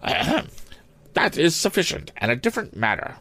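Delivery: put-on high-pitched, measured old maid principal; conceding schoolmistress. A narrator's reading. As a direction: concede it primly in a high headmistress voice. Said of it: "conceded Miss Perkman".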